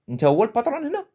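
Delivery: "Nta huwa le patron hena" said high with a rise on "hena"; acted challenge. Shown as a demonstration. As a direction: challenge someone in Darija, pitch up. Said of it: "on peut rajouter le wesh".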